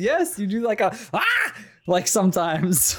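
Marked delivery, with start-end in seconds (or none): 1.1-1.5 very high, sudden "ah"; imitated odd yelp. Showing the co-host their own noise, imitated yelp. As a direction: squawk out a friend's verbal tic. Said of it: "You make that noise".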